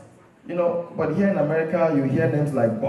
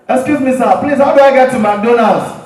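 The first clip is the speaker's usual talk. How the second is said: polite loud request; 6 semitones higher, 8 dB louder than usual. Acted. Asking an African man, louder.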